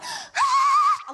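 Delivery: very high, loud scream "Ah!"; re-enacted scream. Speaker at the broken washer, re-enacted scream. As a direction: let out a frustrated shriek. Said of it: "I screamed so loud".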